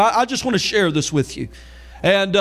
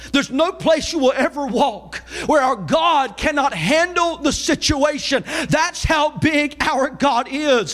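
driving, high-pitched sermon delivery; Southern revival preacher in full flow; full-force preaching; 5 semitones higher, slower than usual. Real, not acted. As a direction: preach it with rising intensity.

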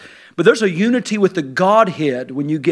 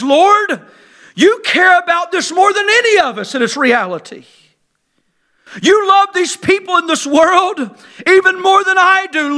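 high, loud, pleading prayer; fervent preached prayer; 12 semitones higher, 5 dB louder than usual. Acted. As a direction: cry out to the Lord with the church. Fervent prayer at full force.

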